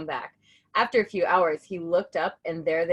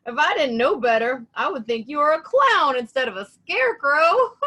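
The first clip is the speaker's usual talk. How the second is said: teasing crow; 7 semitones higher, 9 dB louder than usual. A narrator's reading. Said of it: "said Crow".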